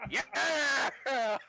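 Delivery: triumphant cheer. Real, not acted.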